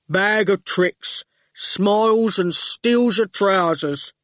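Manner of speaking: slow, terse thug; slow goon voice. Acted. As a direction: plod out each word. The goon's terse voice.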